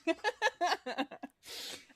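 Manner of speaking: high laugh. Real, not acted. Laughs at the nexus joke.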